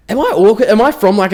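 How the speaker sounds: high, loud baffled self-questioning; mock bewilderment. Reacting to parents' cheek kisses, acted bewilderment.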